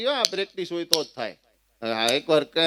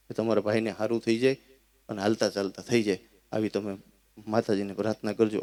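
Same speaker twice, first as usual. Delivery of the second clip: lower, softer, calm and pious; soft reassuring wife; 7 semitones lower, 5 dB quieter than usual. Retold or imitated. Wife calmly tells a visitor her husband will recover. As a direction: gently reassure a worried visitor.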